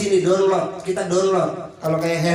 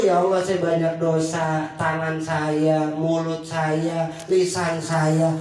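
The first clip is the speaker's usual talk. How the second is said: low, slow, pleading repentance; humble prayer; 5 semitones lower, slower than usual. Retold or imitated. Preacher voices a sinner confessing to God, low and slow. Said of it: "udah ngaku".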